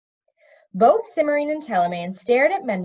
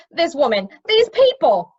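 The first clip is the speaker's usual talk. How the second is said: exasperated; 5 semitones higher, 8 dB louder than usual. A narrator's reading. Harried elf complaining.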